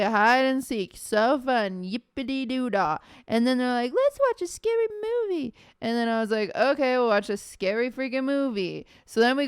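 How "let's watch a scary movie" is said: high, airy mimic of the friends; teasing high-pitched friend imitation; mock friend voice. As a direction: squeak it out like a giddy friend. Friends quoted suggesting scary movie.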